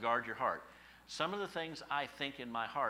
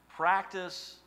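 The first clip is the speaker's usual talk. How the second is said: practice said high and loud; stress on practice; 8 semitones higher, 10 dB louder than usual. Shown as a demonstration. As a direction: punch the word practice. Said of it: "stressed word in verse".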